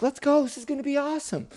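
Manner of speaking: high, quickening delight; eager squeal before a ride; excited princess. Acted. Princess setting off with boy, excited.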